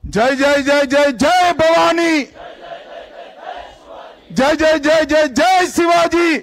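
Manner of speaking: high, loud slogan chant for the crowd; roaring rallying speech. Real, not acted. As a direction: shout the war cry and lead the call-and-response. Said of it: "मेरे साथ बोलिए".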